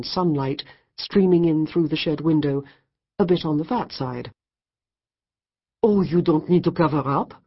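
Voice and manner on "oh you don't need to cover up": put-on French accent. A narrator's reading. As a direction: tease him in a French voice. French woman teasing.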